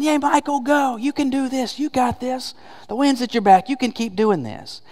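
high-pitched, softened cheer from the grandstand; cheering supporters. Acted. Heaven's crowd cheering on a believer, mock cheering. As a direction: egg them on in a high, small voice. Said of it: "cheering you on".

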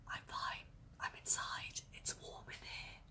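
soft unvoiced whisper; hushed answer to a worried mum; whispered reply. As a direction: whisper it like a secret reassurance.

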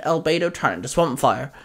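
usual speaking voice

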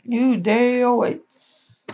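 high, slow movie-trailer voice; dramatic announcer. Pretend.

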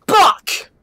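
short high outburst; real startled yell; sudden yelled curse. Real, not acted. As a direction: blurt it out loudly.

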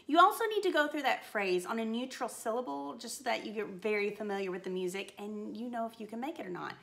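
ordinary delivery

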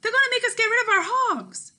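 father's alarmed proclamation; proclaiming father. Acted. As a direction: declare the bad news to the table.

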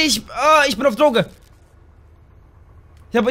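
usual speaking voice

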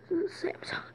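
Samsung whispered softly as an echo; whispering. Shown as a demonstration. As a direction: whisper Samsung right after saying it. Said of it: "whispered repeat".

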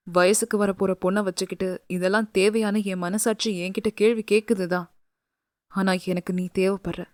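a man admitting his need, low and hushed; husband confessing. Acted. Husband voiced lower, confessing. (தாழ்ந்த குரலில் மனம் திறக்கும் கணவன்)

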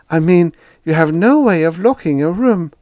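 high, loud fussy landlady; landlady calling down to her tenant, high and loud. A narrator's reading.

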